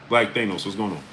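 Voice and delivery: normal talking voice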